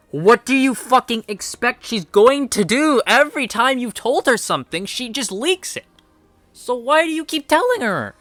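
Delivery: high-pitched, incredulous telling-off; hammed-up exasperation, pitch climbing high; exasperated scolding. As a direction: scold him in pleading, high-voiced disbelief.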